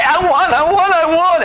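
put-on high-pitched whining child's demand; hammed-up childish pestering. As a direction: mimic a child nagging insistently. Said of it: "When your children say".

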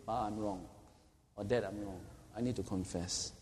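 put-on a quiet child owning up; humble confession. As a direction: admit it meekly to Dad.

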